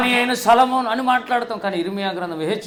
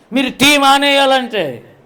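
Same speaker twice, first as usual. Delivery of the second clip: imitating a preacher; 6 semitones higher, 7 dB louder, slower than usual. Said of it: "stop చేసి ఏమన్నాడు తెలుసా?".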